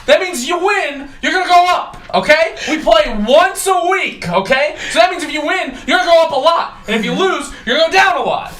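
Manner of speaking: fed-up commissioner explaining the obvious; exasperated rant. Real, not acted. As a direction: lecture the viewers like you've told them a hundred times.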